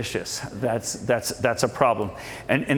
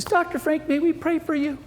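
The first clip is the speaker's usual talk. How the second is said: high-pitched imitation of a woman; imitated woman; 13 semitones higher than usual. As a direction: put on a high female voice to ask. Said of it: "this lovely couple comes up".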